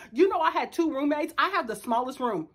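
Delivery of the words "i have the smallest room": ordinary talk, nothing marked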